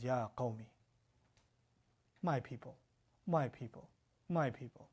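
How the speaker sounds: a prophet gently calling his people after nine centuries, tender and soft; tender plea. Retold or imitated. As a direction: plead softly like a loving prophet. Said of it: "How are you talking like this".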